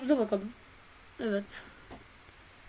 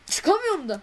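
frustrated cry; 7 semitones higher, 6 dB louder than usual. Real, not acted. Frustrated stuck in game.

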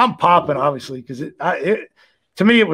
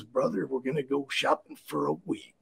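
lower, softer gravel-free Macho Man voice; Macho Man impression; 6 semitones lower, 13 dB quieter than usual. Imitating Macho Man telling his friend about wig shopping, low and soft. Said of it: "He goes, he goes".

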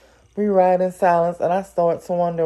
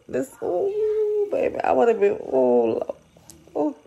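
shocked gasp; 9 semitones higher than usual. Real, not acted. Gasping at the story twist.

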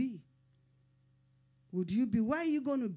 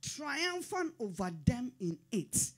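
rising, ringing sermon delivery; full-force gospel preaching; fervent preaching; 3 semitones higher than usual. Acted. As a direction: preach it to the back row.

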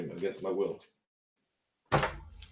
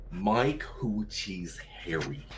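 softer, slow, stretched-out words; slow drawn-out reading; 5 dB quieter, much slower than usual. Pretend. Trying the gag phrase once more, slow drawn-out reading. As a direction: draw each word out slowly. Slow take after quick one.

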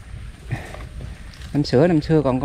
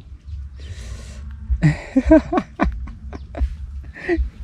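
laughing; 9 semitones higher, 4 dB quieter than usual. Real, not acted. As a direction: burst of giggles. Joking while fishing with uncle. (cười khúc khích)

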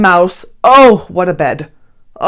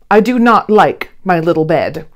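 ordinary delivery

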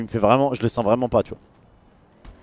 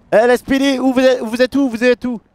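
calling out; 9 semitones higher than usual. Acted. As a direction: shout to find the other cops. Police officer calling out in building.

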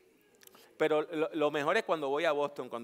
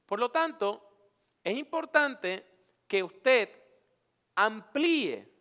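slow emphasis; 7 semitones higher, 7 dB louder, much slower than usual. Real, not acted. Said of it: "slows for emphasis".

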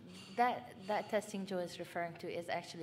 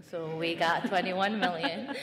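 laughing; 10 dB louder than usual. Real, not acted. Laughing about the extra million.

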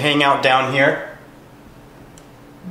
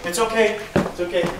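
reassuring; 9 semitones higher, 3 dB quieter than usual. Real, not acted. Calming after ball falls.

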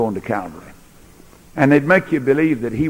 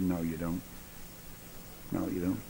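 quiet calm defiance; 6 semitones lower, 17 dB quieter than usual. Retold or imitated. Jesus answering Pilate calmly.

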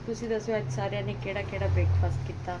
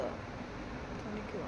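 weak whisper; 8 dB quieter, much slower than usual. Real, not acted. Weak after listing her aches.

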